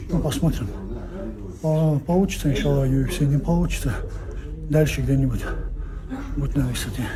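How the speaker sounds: ordinary delivery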